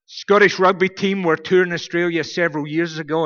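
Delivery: normal talking voice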